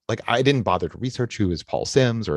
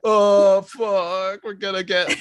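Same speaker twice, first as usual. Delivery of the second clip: panicked; 11 semitones higher, 5 dB louder than usual. Retold or imitated. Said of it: "they're like".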